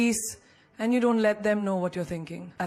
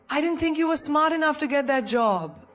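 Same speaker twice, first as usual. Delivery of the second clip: higher, lightly mocking backhanded jab; sarcastic insult; 6 semitones higher than usual. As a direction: sneer it sweetly like a fake compliment. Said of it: "sly insults wrapped in sarcasm".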